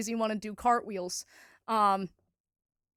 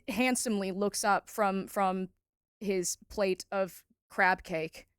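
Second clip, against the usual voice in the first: ordinary talk, nothing marked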